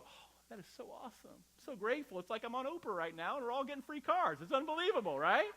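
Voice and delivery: high, giddy, over the top gush; mock gushing. Mary as she did not react, mocked as a gushing game-show winner. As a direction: gush like an Oprah guest getting a free car. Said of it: "And she didn't go".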